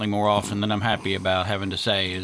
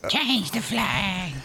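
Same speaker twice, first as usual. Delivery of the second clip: mocking drawl; 9 semitones higher, much slower than usual. Mocking the flag idea.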